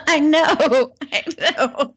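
laughing through the words; giggling while agreeing. Real, not acted. Laughing at crude joke.

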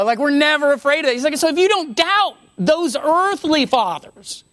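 ordinary talk, nothing marked

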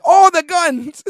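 high, very loud comic yell; over-the-top high-pitched shouting; mock shout. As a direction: bellow it as a retold punchline. Shouted punchline of acted exchange.

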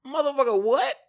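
loud, high outraged what; outraged disbelief. Retold or imitated. Busboy stunned by his manager, loud outraged disbelief. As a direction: blurt it out in disbelief.

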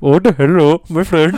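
imitation Indian accent. Friend calls out Indian, mock Indian accent.

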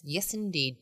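ordinary delivery

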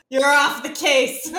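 loud, drawn-out, hammed-up police chief line; mock cop-boss firing. Friend joking about Reva being disowned, hammed-up angry boss.

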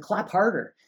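mock pastor urging; retold urging. Retells pastor urging the crowd on.

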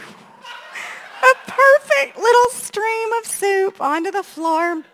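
ordinary talk, nothing marked

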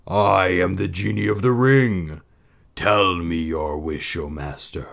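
slow, grand genie voice; genie of the ring. A narrator's reading. Little genie greeting his new master, slow and grand. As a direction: intone it like a magic servant.

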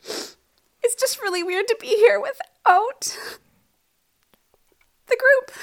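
fighting not to cry; holding back tears. Real, not acted. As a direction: voice high, breaking with tears. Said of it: "I'm just trying not to cry".

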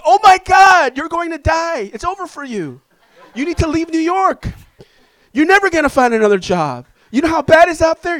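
high, loud mock freakout voice; panicky friend. Friend hearing bad news, hammed-up high panic. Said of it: "friends that just freak out".

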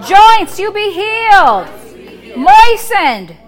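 loud, slow commands to the body; preaching at full force. Acted. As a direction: call out each body part, congregation echoes. Call-and-response healing.

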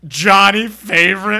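slow, loud, high astonished question; loud high drawn-out surprise; mock surprise. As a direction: gasp out the name. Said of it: "she's like".

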